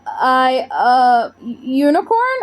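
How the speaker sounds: stammering in shock; girl spluttering at the unicorn. Acted. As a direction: trip over the words, stunned. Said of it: "I spluttered".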